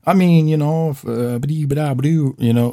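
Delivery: mock your own evasive dithering; beating around bush. Asking his buddy for help, comically evasive. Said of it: "kinda beating around the bush".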